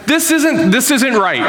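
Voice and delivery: put-on high-pitched exasperated kid protest; imitated frustration. Daughter stuck on homework with her parents, frustrated. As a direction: act out a frustrated daughter, voice jumping up. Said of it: "She gets frustrated, and she's like".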